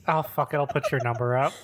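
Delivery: ordinary talk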